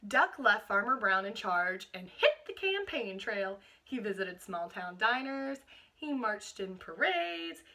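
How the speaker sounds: put-on reading a picture book aloud to children; steady storytelling read-aloud; picture-book narration for a class; storybook reading.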